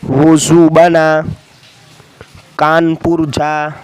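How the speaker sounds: teacher reading a sentence slowly. Shown as a demonstration. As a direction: read it out slowly word by word. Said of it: "आप सुने इसे".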